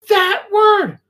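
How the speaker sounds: high, loud mock-mom exasperation; exasperated scolding. Mother scolding her son at dinner, exasperated. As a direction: land it hard, like a scolding mother.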